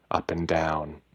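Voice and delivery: usual speaking voice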